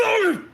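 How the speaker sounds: high, loud pained yelp; pained grunt. Acted. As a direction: let out a sharp pained cry. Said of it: "You just hear Scarlet go".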